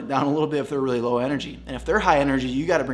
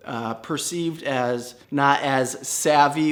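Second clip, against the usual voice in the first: slowed down; much slower than usual. Shown as a demonstration. Slow-talker point.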